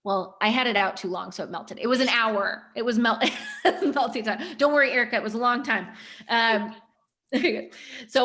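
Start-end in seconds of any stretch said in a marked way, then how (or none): 2.8-4.4 words broken by giggles; laughing. Real, not acted. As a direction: laugh through the sentence. Laughs while explaining.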